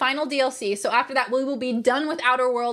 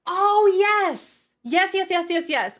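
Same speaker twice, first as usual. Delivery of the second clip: excited recall; 7 semitones higher, 4 dB louder, slower than usual. Real, not acted. Suddenly remembering, excited.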